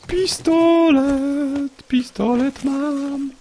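very high, slow drawn-out triumphant cry; ecstatic find. Real, not acted. Streamer thrilled at finding a pistol, high slow drawn-out joy. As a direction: stretch the word out in delight.

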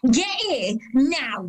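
high-pitched call down a carriage; shouting. Acted. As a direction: yell for a child to come at once, pitched high. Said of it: "I thought she was shouting at me".

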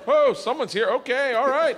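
high, loud startled gasp of a crowd. Retold or imitated. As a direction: play the whole room caught off guard. Mock crowd reaction in bar.

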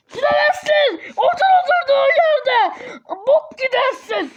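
loud, high-pitched parental yelling; furious mock-parent outburst; angry refusal. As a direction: shout the child down. Parent forbids child to leave, acted.

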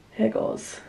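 whispering under breath; nervous whisper. Real, not acted. Nervous before filming drizzle.

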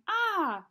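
sharp high yelp of fright; mock a startled cry; quick high gasp of shock; retold startled yelp. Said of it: "he had run away from August going".

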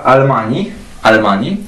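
almani with a clear final i; Arabic pronunciation. Shown as a demonstration. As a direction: demo almani for the class. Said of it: "pozycja końcowa litery ja". (arabska wymowa almani)

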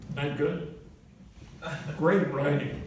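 ordinary talk, nothing marked

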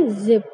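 जिप as "zip", buzzing z; z sound in जिप. Shown as a demonstration. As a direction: start जिप on a z. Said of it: "तो इसे हम क्या पढ़ेंगे".

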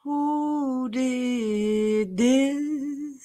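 very slow, stretched-out disbelief; horrified disbelief. Real, not acted. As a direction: drag every word out in shock. Horrified reaction to clip.

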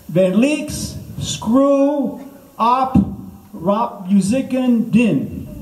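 high, very slow, word by word; mock language-lesson recitation. Shown as a demonstration. Comic teaching the crowd Norwegian, slow high recitation. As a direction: stretch each foreign word for repeating.

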